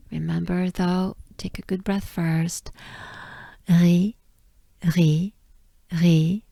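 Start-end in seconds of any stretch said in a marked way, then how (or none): none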